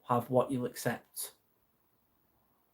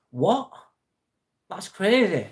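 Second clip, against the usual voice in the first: surprised; 7 semitones higher, 6 dB louder, slower than usual. Real, not acted. Reacting to a silly donation.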